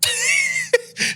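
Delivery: very high squealing laugh; high real squeal of laughter; squeaky laugh. Real, not acted. Laugh after impression.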